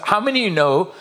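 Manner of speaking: ordinary talk, nothing marked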